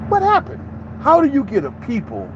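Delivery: high, disbelieving "what happened"; sharp high question of disbelief; incredulous question. Real, not acted. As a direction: ask it like you can't believe it.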